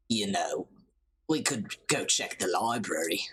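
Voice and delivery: low murmured character voice; low-voiced game character. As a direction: drop right down for a laid-back reply.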